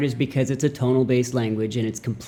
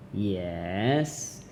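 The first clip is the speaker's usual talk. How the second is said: "Yes" drawn out, dipping low then rising; teacher tone; 4 semitones lower, 8 dB quieter than usual. Shown as a demonstration. Teacher demonstrating third tone, "Yes" soft, dipping then rising. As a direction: scoop "Yes" down and up, softly. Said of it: "teacher tone".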